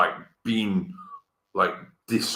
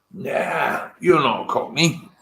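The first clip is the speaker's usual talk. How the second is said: Cockney voice; 9 semitones higher, slower than usual. Retold or imitated. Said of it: "Cockneys would have said".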